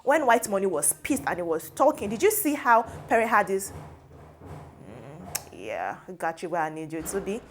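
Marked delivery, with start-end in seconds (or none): 4.8-7.4 low, soft, self-satisfied murmur; imitated smug aside. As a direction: drop down low as if gloating privately. Voices Pere's smug inner thought.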